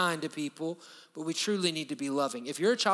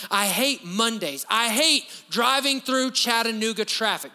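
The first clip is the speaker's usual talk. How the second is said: mock complaining; 5 semitones higher, 5 dB louder than usual. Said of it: "We use this term".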